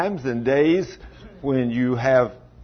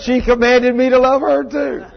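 normal talking voice

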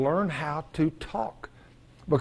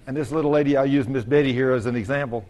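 usual speaking voice

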